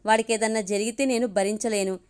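usual speaking voice

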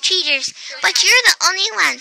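a hint of a kindly old woman; old lady voice. A narrator's reading. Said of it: "said Miss Tibbles".

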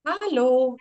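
ordinary talk

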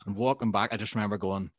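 ordinary talk

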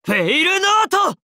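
very high, ringing battle cry; attack cry. Acted. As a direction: call out the move at the top of your range. Calls out the attack name. (甲高い必殺技の叫び)

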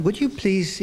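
normal talking voice

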